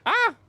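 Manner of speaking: sudden high yelp; startled scream. Real, not acted. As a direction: shriek in fright. Jump scare in game.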